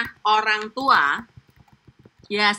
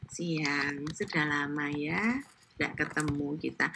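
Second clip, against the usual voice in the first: lower, softer gentle reply; soft-spoken; 7 semitones lower, 12 dB quieter, slower than usual. Retold or imitated. Solo woman replying quietly and gently. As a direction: answer softly, almost shy. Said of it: "Terus dia bilang".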